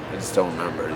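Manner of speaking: usual speaking voice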